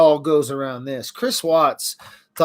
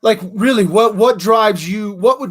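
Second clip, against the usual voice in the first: emphatic raised voice; 7 semitones higher, 7 dB louder than usual. Real, not acted. Passionate rant about criminal mind.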